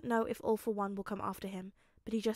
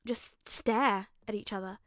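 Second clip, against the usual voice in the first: usual speaking voice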